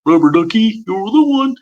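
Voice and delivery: high, chuckly puppet voice; Ernie from Sesame Street. Shown as a demonstration. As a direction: play it like Ernie, pitched well up. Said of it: "Ernie's very low".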